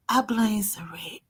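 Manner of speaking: joking whispered blame. Real, not acted. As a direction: whisper it as a teasing aside. Playful aside blaming a friend.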